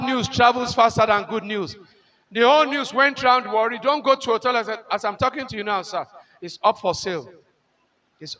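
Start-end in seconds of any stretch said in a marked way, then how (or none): none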